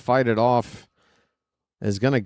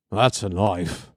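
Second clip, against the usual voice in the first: lower, mock Australian accent with "That's" punched and a falling end; "That's a knife" in a crap Crocodile Dundee Australian accent; clumsy Australian accent, settled and falling; bad Australian accent; 4 semitones lower than usual. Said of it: "My Australian accent is crap".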